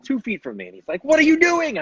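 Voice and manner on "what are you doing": high-pitched, loud mock outrage; retold indignant shout; livid. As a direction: imitate an angry stranger yelling up high. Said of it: "he's, he's livid".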